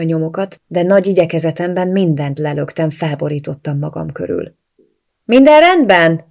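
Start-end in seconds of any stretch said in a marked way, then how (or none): 5.2-6.2 raised, higher, slow worried call through the door. A narrator's reading. Katie checks on Bianca through the door, worried call. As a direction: call out in concern, drawn out. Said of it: "aggodalmaskodott Katie".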